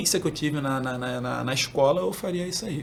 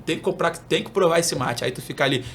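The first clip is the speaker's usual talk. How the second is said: ordinary delivery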